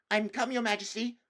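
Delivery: put-on high, keen boy's greeting; eager. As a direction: bound forward to please her. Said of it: "rushing eagerly forward".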